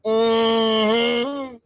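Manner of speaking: high, drawn-out mock hum; long high "mm" put on as the statue woman; imitated hum. Streamer imagining what the statue woman would say, imitated hum. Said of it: "she's just ready to go".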